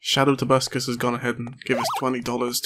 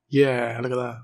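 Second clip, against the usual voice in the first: ordinary talk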